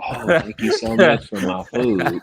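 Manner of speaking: high, loud, gushing mock thank-you; imitating an overeager customer at the door; eager customer. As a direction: gush like a customer grabbing their food.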